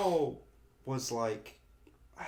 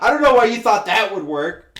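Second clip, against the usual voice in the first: exasperated teacher; 6 semitones higher, 12 dB louder than usual. Retold or imitated. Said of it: "in her head she was like".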